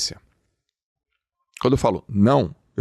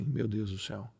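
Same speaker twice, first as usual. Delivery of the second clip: much softer, half to oneself; soft dismay; 9 dB quieter than usual. Retold or imitated. The viewer reacting to his advice, soft dismay. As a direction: mutter it under the breath, overwhelmed. Aí você vai falar assim.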